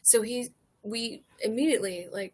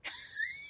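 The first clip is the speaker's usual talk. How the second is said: high squeak laugh; 10 dB quieter than usual. Real, not acted. Laugh after crude quote.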